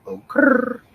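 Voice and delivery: high, loud "oh" into a rolled "krrr". Retold or imitated. Teacher imitating a commercial, high trilled "oh, krrr". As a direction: trill the R loudly, pitched high. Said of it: "[name] did it best".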